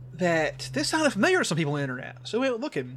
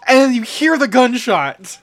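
dramatic build; 7 semitones higher, 11 dB louder, slower than usual. Retold or imitated. Dramatic retelling of ending.

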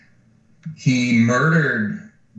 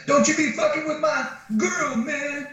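much higher, cartoonish tough-guy squeak. Jealous boyfriend threatens stranger over his girl, hammy high voice. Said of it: "playing it up, hamming it up".

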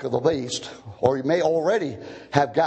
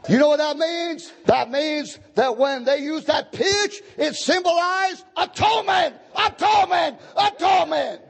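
full-force preaching; 12 semitones higher than usual. Real, not acted. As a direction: drive each key word home like a revival preacher.

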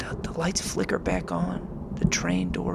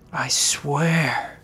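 frantic whisper; 4 dB louder, slower than usual. Acted. Terrified narrator near breaking.

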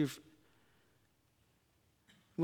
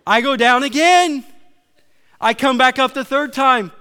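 loud, high, urgent storytelling; raised panicked retelling; 8 semitones higher, 13 dB louder than usual. Retold or imitated. Preacher reliving his near drowning, loud and high. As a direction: push it out like you're still gasping for air.

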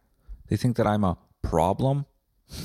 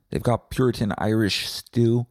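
usual speaking voice

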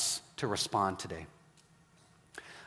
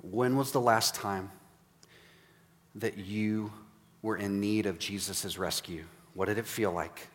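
guided reflection; 4 semitones lower, 5 dB quieter than usual. Acted. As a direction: lead them gently to remember. Guided reflection over music.

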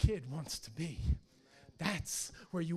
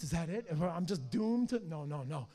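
ordinary delivery

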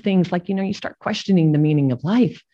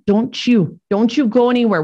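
higher, louder mock order; retold insistent plea; 5 semitones higher, 5 dB louder than usual. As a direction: insist firmly, as if forbidding him to go. Retold plea to ailing grandpa.

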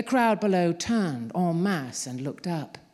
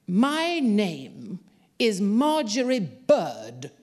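loud, slow, defiant bellow; 5 semitones higher, 4 dB louder, much slower than usual. A narrator's reading. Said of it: "she bellowed".

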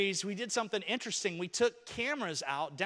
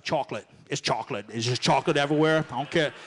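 lightly voiced giddy woman, louder; mock excited lady; 3 semitones lower, 10 dB louder, faster than usual. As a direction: gush about chocolate like a kid. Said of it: "one lady was on there. She's like".

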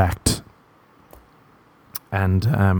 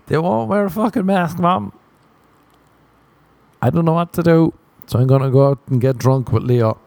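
higher, mock exasperated whine; whiny son to mom; 5 semitones higher than usual. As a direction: grumble at mom, voice pitched up. Rant continues to mom.

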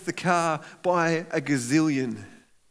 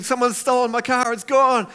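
rising, louder panicked cry; mock panic; 7 semitones higher, 6 dB louder than usual. Churchgoer at the car park, loud mock panic. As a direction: play the owner who thinks the car is stolen. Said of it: "you're freaking out".

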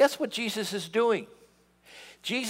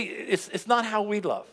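ordinary talk, nothing marked

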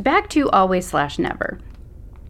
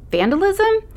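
very high, outraged disbelief; incredulous mockery; 13 semitones higher, 4 dB louder than usual. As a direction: fling the word back in scorn. Macy mocking teacher's accusation.

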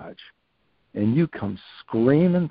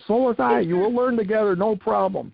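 imitating Coach Ferguson; 9 semitones higher than usual. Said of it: "He's over".

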